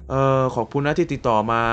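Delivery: usual speaking voice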